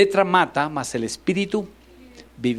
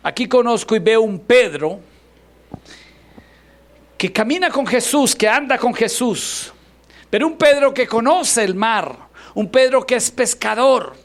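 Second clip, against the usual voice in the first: higher, louder preaching build; fervent preaching; 9 semitones higher, 6 dB louder than usual. Acted. Pastor preaching about Peter to the church, loud and fervent. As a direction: drive each Pedro home.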